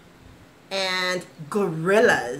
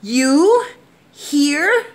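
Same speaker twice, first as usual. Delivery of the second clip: high, loud startled gasp; surprised little bird gasp; 8 semitones higher, 6 dB louder than usual. Acted. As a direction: blurt it out in disbelief. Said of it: "gasped Mister Bird".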